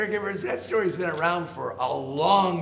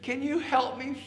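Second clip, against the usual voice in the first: high rabbit voice, worried; pleading rabbit; 9 semitones higher than usual. A narrator's reading. Rabbit begging elephant to clear his home, high pleading voice. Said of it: "He said".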